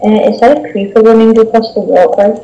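ordinary delivery

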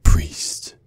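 unvoiced whisper in his ear. Villain whispering to the captive priest. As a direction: breathe it close and cold.

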